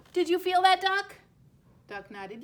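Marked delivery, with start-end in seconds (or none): 0.1-1.2 much higher and louder, breathless; excited. Acted. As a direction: burst out thrilled. Egg moves, excitement.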